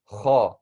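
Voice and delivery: kha scraped from the back of the throat, mouth open; heavy kha. Shown as a demonstration. Teacher demonstrating kha to Arabic beginners, heavy and throaty. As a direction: say kha as a heavy letter, not smiling. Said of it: "the first heavy letter".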